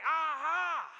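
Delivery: loud, high, drawn-out mock "aha"; imitating a gloating ambusher; gotcha cry. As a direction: pounce with a triumphant aha, hammed-up. Neighbor jumps out, gotcha.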